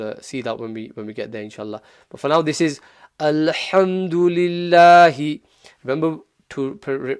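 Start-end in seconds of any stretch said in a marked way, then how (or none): 3.2-5.4 ALHAMDULILLAHI recited with ha from mid-throat. Shown as a demonstration. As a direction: recite ALHAMDULILLAHI, louder, breathy ha, long final vowels. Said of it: "pronounce the ha from the middle of the throat".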